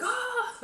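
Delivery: very high, loud laughing shriek; startled scream. Retold or imitated. Brother startled awake, screaming.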